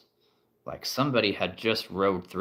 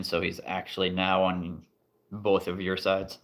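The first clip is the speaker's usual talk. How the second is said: usual speaking voice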